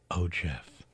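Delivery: soft and low, barely voiced; very softly. A narrator's reading. Doris greets returning Jeff, very soft and low. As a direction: breathe his name softly. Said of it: "she said very softly".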